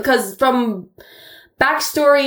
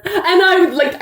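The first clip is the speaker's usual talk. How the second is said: ordinary talk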